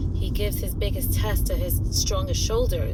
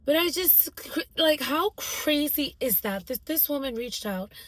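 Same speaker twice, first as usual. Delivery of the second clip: amazed; 8 semitones higher, 4 dB quieter than usual. Real, not acted. Amazed at the coincidence.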